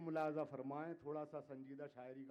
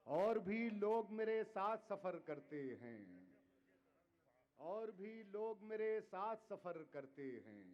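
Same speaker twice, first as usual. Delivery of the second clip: measured, declaiming poetry to audience; ghazal recitation; 7 semitones higher, 4 dB louder, slower than usual. Acted. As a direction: recite each couplet with weight. Poet repeats line.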